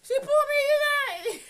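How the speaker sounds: high, loud whining cry; mock whiny son. Mother mocking her son complaining about being called out. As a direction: whine it like a wounded kid. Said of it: "backlash that you get mad, like".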